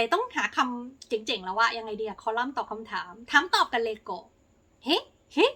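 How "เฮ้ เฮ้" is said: high, bright mock cheer; playful cheer. Real, not acted. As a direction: tease yourself with a little hey hey. Playful cheer at own idea. (เฮ้แบบแซวเล่นเสียงสูง)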